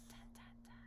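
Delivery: breathless whispered suspense sting; whispered dun dun dun. Pretend. Reader whispering a suspense sting at the lion's charge. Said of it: "reader adds suspense sound".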